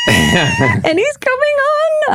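high thrilled squeal; excited shout. Real, not acted. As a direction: squeal it out with joy. Thrilled dream guest coming.

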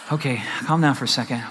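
low, soft pretend reassurance; mock calm. Preacher joking about a father unbothered by losing a child, mock calm.

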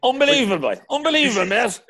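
high, loud put-on voice; mock exclamation. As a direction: cry out in mock disbelief. Mock exclamations in Cork soccer banter.

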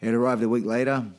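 normal talking voice

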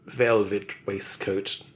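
ordinary delivery

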